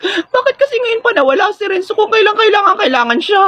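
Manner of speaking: high, panicky whine; nervous wail. Acted. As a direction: wail in dismay at being left to it. Nervous contestant laments missing teammate. (natatarantang pagrereklamo)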